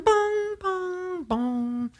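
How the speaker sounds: high soft bong sounds, fading; synth bong imitation. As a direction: sing the synth bongs softly and high. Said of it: "that bong".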